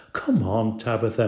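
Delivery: ordinary delivery, nothing marked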